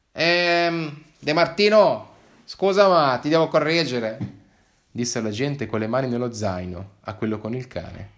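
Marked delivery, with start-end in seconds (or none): 0.1-4.2 higher, louder, odd-voiced correction; hesitant agent. Agent corrects De Martino about the canton, awkward. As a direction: interrupt your partner awkwardly.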